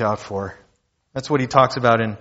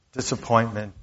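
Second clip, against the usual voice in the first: ordinary talk, nothing marked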